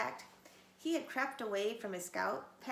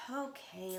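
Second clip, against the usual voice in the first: sighed; 5 dB quieter than usual. A narrator's reading. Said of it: "Little Wolf sighed".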